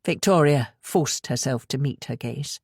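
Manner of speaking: ordinary delivery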